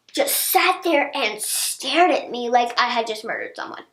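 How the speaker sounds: ordinary delivery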